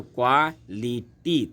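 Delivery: Quality in three beats, KWA, LI, TI; syllable by syllable. Shown as a demonstration. Teacher asking students to notice, quality split in three. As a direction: separate each syllable of quality. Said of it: "तीन शब्द आ गए".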